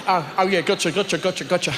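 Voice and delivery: call center agent. Retold or imitated. Call center agent pretending to understand the caller. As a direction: nod along blankly. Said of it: "They go".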